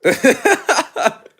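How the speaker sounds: loud high cackling laugh; real howl of laughter; burst of laughter. Real, not acted. A streamer cracking up at chat, loud high laugh. After reading a roast comment.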